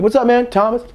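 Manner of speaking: high, bright hello to new faces; nervous kid introducing himself loudly; eager greeting. Retold or imitated. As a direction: pitch way up, overeager. Greeting strangers in the car.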